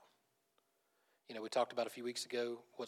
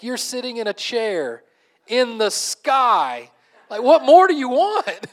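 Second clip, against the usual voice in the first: loud, high, drawn-out mock outrage; incredulous comedian; 12 semitones higher, 20 dB louder, much slower than usual. Comedian scolding spoiled airline passengers, incredulous shouting. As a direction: stretch each word, building to a shouted punchline. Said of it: "I always remember the way he said this".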